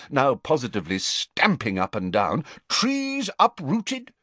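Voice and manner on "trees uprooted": Prime Minister. Acted. Prime Minister listing damage.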